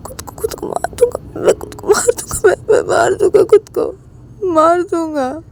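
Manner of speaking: high, sobbing cry of despair; crying threat. Acted. Ravi threatens to kill himself, sobbing. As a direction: wail it out through tears.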